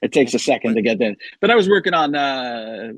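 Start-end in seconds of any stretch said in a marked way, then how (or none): none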